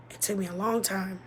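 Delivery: ordinary talk, nothing marked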